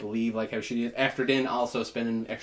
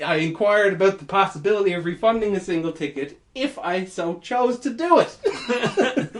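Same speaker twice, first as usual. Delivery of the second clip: mock formal; 6 semitones higher, 5 dB louder than usual.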